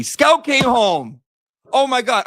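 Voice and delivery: real high, loud, drawn-out cheer; overjoyed shout. Real, not acted. Streamer telling viewers the lost cat came home, in an overjoyed shout. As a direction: cry out the good news, thrilled.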